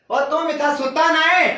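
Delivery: usual speaking voice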